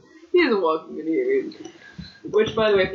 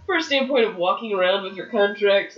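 ordinary talk, nothing marked

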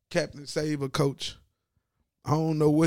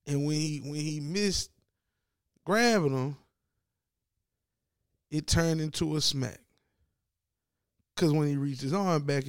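ordinary delivery

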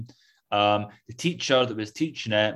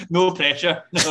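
laughing, wry joke; 6 semitones higher, 5 dB louder than usual. Real, not acted. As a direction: say it with a dry laugh. Joking about big rival film.

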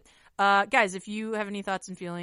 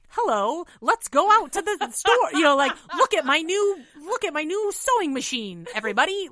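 high, loud Mickey Mouse-like voice; cartoonish squeaky voice; 8 semitones higher, 8 dB louder than usual. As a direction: overdo a silly cartoon voice. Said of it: "Sounds like Mickey Mouse".

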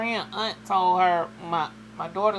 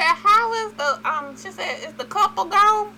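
much higher, as the mother asking; mimicking mom; 14 semitones higher, 5 dB louder than usual. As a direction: play the curious mother. Mother voiced high.

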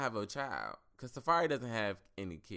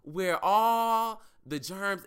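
loud disgust; 7 semitones higher, 8 dB louder, much slower than usual. Real, not acted. Disgusted rant about wrestling mats.

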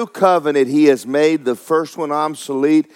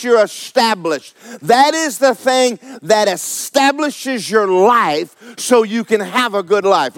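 fired-up, higher-pitched preaching; real excitement from the pulpit; excited preaching; 6 semitones higher, 4 dB louder than usual. Real, not acted. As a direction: preach it with rising excitement, driving the key words. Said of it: "I got so excited all of a sudden".

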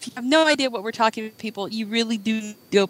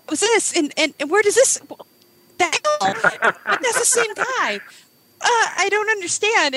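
high-pitched, stammering bafflement; hammed-up confusion, pitch way up; confused; 10 semitones higher than usual. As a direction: act out being totally lost. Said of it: "I was actually sort of confused".

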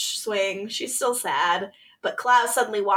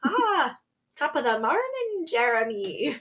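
lilting stage-Irish voice, pitched up; hammed-up Irish brogue; playful imitation of an Irish accent; put-on Irish accent; 4 semitones higher than usual. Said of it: "But he does it with an accent".

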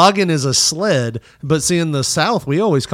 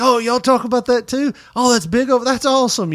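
much higher, light imitation of a London man; a hint of a London accent; English guest; 10 semitones higher than usual. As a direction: lightly play the excited English visitor. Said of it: "he's like".

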